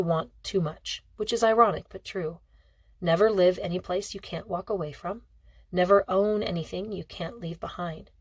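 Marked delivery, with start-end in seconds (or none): none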